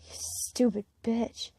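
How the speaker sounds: slow, soft, bitter mumble under the breath; a hurt girl mumbling an insult at her guardian; mumbled. A narrator's reading. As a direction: mutter it slowly and quietly. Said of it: "I mumble".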